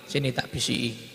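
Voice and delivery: low, soft call to come close; hushed invitation. Acted. Prophet calling his daughter close, hushed. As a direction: draw her near to whisper. Prophet calls Fatimah to whisper.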